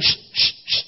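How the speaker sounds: hushing. Retold or imitated. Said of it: "The disciples are going".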